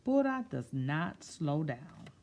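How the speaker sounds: put-on slow, expressive read-aloud to children; storybook reading. A narrator's reading. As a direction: read it like story time, savouring each word. Reads picture book aloud.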